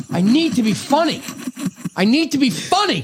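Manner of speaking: high, strained voice pleading to himself; desperate comic. Retold or imitated. Friend voiced sweating, desperate.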